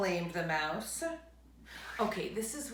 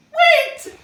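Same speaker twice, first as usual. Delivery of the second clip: much higher, much louder cry; 19 dB louder than usual. Acted. Bear calls after departing mouse, loud cry. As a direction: shout out to stop him. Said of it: "cried Bear".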